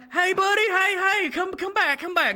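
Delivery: high, loud call after someone. Retold or imitated. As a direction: shout after him to come back. Calling after patient walking away.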